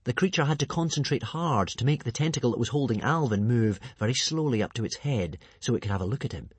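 plain reading voice

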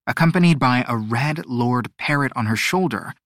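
ordinary delivery, nothing marked

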